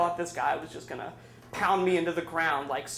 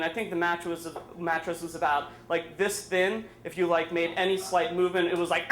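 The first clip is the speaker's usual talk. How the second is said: plain reading voice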